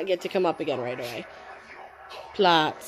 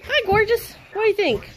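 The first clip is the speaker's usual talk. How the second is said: high, louder sing-song for a pet; 13 semitones higher, 5 dB louder than usual. Real, not acted. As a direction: coo at the dog in a high voice. Baby talk to dog.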